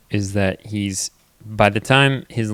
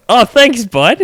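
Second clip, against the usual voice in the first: high loud bright dad voice; loud high thanks bud; cheery thanks to toddler; 13 semitones higher, 12 dB louder, slower than usual. Retold or imitated. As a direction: thank a little kid warmly.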